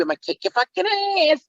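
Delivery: very high, squealed comic threat; cartoonish tough-guy voice; Pee-wee. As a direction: squeak out the movie catchphrase, stretching the end. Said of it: "it's really in the movie".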